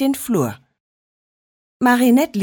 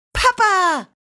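viel höher, jubelnder Ruf; Freudenschrei; 12 semitones higher than usual. A narrator's reading. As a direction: ruf Papa voller Freude. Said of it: "schrie sie vor Freude". (Tochter entdeckt die Wichteltür, jubelt hoch nach Papa)

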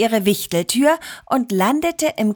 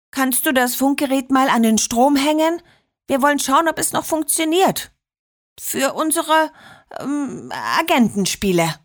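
put-on higher child's voice, stalling before the excuse; feigned innocence; 5 semitones higher than usual. A narrator's reading. Liliana gets dad to charge the radio, feigning innocence. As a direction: ask innocently, then fumble for a cover story. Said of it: "fragte sie unschuldig".

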